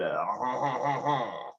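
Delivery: quiet sleazy mock laugh; hushed lecherous chuckle; dirty laugh. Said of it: "dirty French man there".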